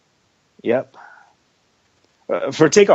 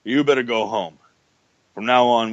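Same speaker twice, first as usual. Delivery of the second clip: ordinary talk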